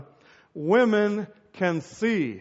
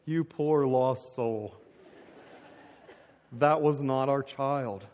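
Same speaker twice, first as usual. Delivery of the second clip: low, slow, soft condescension; pitying wife; 7 semitones lower, 5 dB quieter, slower than usual. Retold or imitated. Wife with hand on husband's thigh, pitying. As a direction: console him softly and slowly, as if pitying.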